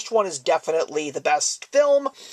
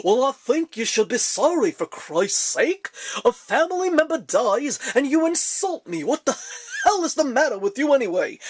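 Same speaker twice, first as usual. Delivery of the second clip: put-on comic voice, pitched up, mock outrage; hammed-up indignant phone impression; hilarious prank-call voice; 4 semitones higher than usual. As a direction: do [name]'s indignant prank-caller act. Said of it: "with that hilarious voice".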